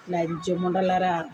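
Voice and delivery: low, slow, spat-out curse; low contemptuous curse. Real, not acted. Vlogger curses her online critics, low and slow. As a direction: drag the insult out low and slow. Cursing commenters in rant.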